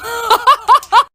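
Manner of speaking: high loud burst of laughter; real laughing fit; big laugh. Real, not acted. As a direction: crack up at the punchline. Mom laughs at end of story.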